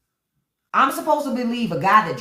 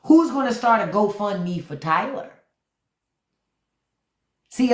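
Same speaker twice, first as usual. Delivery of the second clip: ordinary delivery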